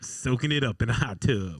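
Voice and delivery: usual speaking voice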